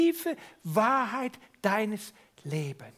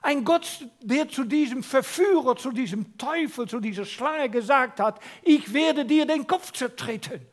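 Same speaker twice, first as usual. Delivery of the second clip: higher, louder preaching, building to grace; forceful preaching; 6 semitones higher, 6 dB louder than usual. Real, not acted. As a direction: proclaim the gracious God with force. (leidenschaftliche Predigt)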